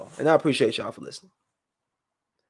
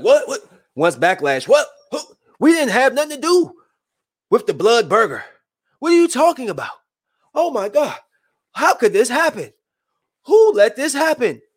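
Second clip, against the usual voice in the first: much higher, frantic mock corporate voice; mock panicked McDonald's; 9 semitones higher than usual. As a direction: play McDonald's panicking and passing blame, high-pitched. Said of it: "But McDonald's like".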